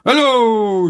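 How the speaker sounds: loud, high hello; a rabbit hollering hello across the water; cheery shout. A narrator's reading. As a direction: call it out big and friendly. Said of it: "shouts Grumpy Rabbit".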